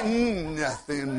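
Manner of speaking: soft, low, fading mumble; muttering. Retold or imitated. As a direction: mutter it away under your breath. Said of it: "go from room to room muttering".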